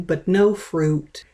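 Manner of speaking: plain reading voice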